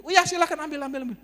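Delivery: high, loud retold urging; insistent, repeated high-pitched offer; eager urging. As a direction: imitate a landlord pressing it on you. Landlord urges him to take it.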